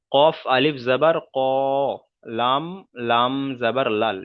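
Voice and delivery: slow, measured Arabic letter-by-letter recitation; spelling drill. Shown as a demonstration. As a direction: spell it out deliberately for learners to follow. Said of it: "প্রথম বানান করলাম". (ধীরে ধীরে আরবি বানান করে পড়া)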